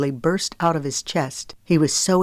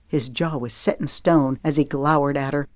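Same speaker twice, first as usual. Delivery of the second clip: usual speaking voice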